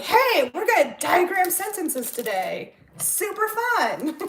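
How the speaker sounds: high, peppy hammed-up pitch, drawn out on "fun"; put-on teacher enthusiasm. Teacher hyping up kids for diagramming sentences, put-on enthusiasm. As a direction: sell a dull task as super fun. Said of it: "acting and".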